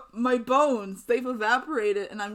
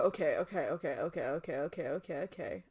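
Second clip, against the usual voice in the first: fading softer and softer "okay"; calming down; 5 semitones lower, 8 dB quieter than usual. Real, not acted. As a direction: talk yourself down quietly. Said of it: "I can't be too loud".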